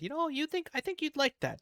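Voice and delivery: high, bright quoting voice; wife's voice. Retold or imitated. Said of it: "and said".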